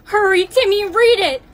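loud, high urging; urgent shout. Acted. Urging Timmy to read the lyric, urgent shout. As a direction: shout at him to hurry.

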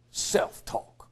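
whispered mockingly. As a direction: breathe it like a secret. Mocking repeat of therapist jargon.

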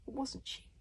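ordinary delivery, nothing marked

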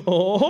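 long, high, loud wavering "oooh"; drawn-out juvenile hoot; childish excited whoop. Retold or imitated. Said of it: "how much of a fucking child".

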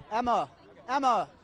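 calling loudly over a crowd. Real, not acted. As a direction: call her name, high and loud.

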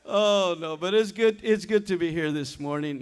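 ordinary talk

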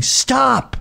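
a high, mock-annoyed stop stop; a high, pleading mock nag; nagging woman. Woman holding his leg down, a high mock nag to stop.

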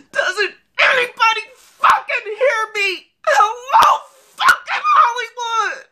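high, sobbing furious yell; crying shout. Acted. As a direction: scream it through tears at the world. Screams at Hollywood crying.

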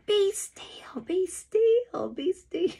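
very high, urgent repeated cries; repeated yelling. A narrator's reading. Brother to child fleeing bees, yelling. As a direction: keep crying it out high and urgent. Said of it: "Ray just keeps yelling".

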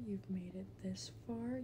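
unvoiced whisper to herself. Real, not acted. Player alone on the map, whispering to herself. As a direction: breathe it like a secret. Whispering to self mid-game.

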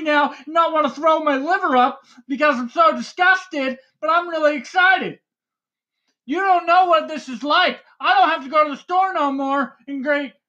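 can't contain the excitement; excited yelling. Real, not acted. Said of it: "why are you yelling".

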